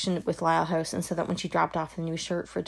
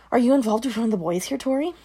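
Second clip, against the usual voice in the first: louder, higher and fast, pressing hard; sharp interrogation; 5 semitones higher, 6 dB louder than usual. Acted. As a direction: corner her with the question. Mother interrogating daughter.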